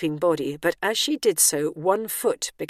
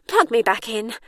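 raised-pitch starlet order; mildly aggrieved demand; 5 semitones higher, 4 dB louder than usual. A narrator's reading. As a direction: order it with mild impatience, voice lifted. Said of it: "slightly more aggrieved tone".

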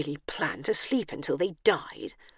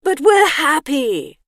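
loud, slow dreamy voice; drawn-out happy sigh of a dreamer; dreamy man; 9 semitones higher, 9 dB louder, slower than usual. Acted. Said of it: "sleepy man answers".

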